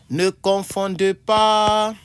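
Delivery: loud slow classroom heading; emphatic announcement. Shown as a demonstration. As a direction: call out the heading like a teacher. Teacher announcing each word pair.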